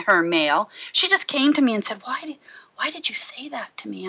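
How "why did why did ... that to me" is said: a soft, wondering whisper; hushed whisper. Retold or imitated. The woman comes back to ask him privately, whispering. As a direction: whisper it, almost afraid to ask.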